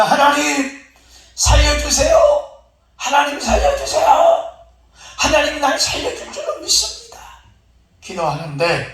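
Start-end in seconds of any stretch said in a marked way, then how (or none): 0.0-7.2 high-pitched cry to God for rescue; pleading prayer. Acted. Flood victim praying to God up a tree, pleading. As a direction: call out to heaven, stretching the last syllables. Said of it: "거기서 기도를 합니다".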